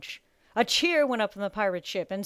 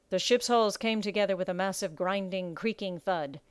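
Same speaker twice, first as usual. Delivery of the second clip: ordinary delivery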